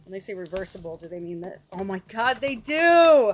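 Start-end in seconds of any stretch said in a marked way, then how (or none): 1.7-3.3 loud, high burst of joy; excited shout. Real, not acted. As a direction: shout it out with delight. Discovering the original cover inside.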